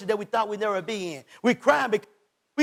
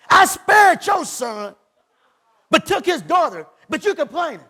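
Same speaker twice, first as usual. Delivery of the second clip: God declaring; 7 semitones higher, 5 dB louder than usual. Acted. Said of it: "No weapon formed should prosper".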